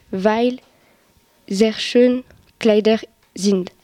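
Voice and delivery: ordinary talk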